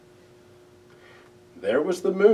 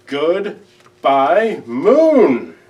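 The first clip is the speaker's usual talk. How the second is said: much louder, calling far off; shouted goodbye; 3 semitones higher, 13 dB louder, much slower than usual. A narrator's reading. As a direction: call it across the hills as loud as you can. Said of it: "He shouted as loudly as he could".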